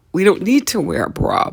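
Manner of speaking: ordinary talk, nothing marked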